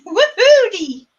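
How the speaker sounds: high, loud mock whoop; playful whoop. Host cheering about being nice and fresh, playful whoop. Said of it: "How do I do that in a Southern accent?".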